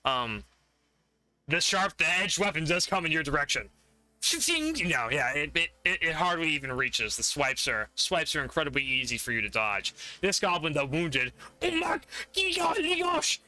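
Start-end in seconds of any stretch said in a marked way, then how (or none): 4.2-4.7 high-pitched vocal "shing" of steel; blade swish sound. Acted. Game master voicing a swung blade, high ringing imitation. 11.6-13.4 high-pitched frustrated goblin. Acted. Wounded goblin complaining to the other one, high creature voice. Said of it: "he's, like, saying to the other one".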